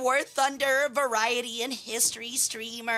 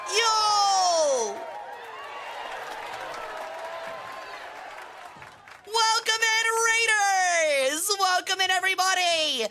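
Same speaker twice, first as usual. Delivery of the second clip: excited welcome shout; 8 semitones higher, slower than usual. Real, not acted. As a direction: welcome the raiders with energy. Welcoming incoming raiders loudly.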